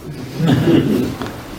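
breathy chuckle. Real, not acted. Joke about Atlanta.

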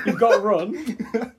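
high, drawn-out, amused cry; laughing delight. Real, not acted. Speaker credits friend's one right word, laughing. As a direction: stretch it slowly, high with amusement.

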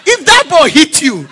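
high, loud mock scolding of a schoolboy; scolding house master. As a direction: play the house master warning the boy, half laughing. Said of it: "and even the house master say".

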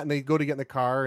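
ordinary talk, nothing marked

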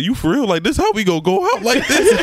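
high, incredulous protest breaking into a laugh; retold disbelief. Boyfriend protesting the way they break up, retold disbelief.

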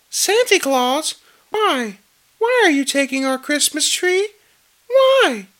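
put-on tiny child in a very high falsetto; Cindy Lou Who. Two-year-old questioning Santa about the tree, squeaky child voice. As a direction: squeak it out slowly, like a wondering toddler. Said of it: "You like that voice".

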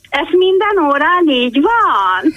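high, drawn-out mock disbelief; astonished quote. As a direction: imitate an incredulous visitor, stretching the words. Visitor's astonished question, quoted. (csodálkozást utánozva, magasan, elnyújtva)

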